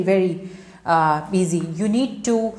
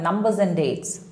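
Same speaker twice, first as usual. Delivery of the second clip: usual speaking voice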